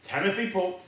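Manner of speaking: plain reading voice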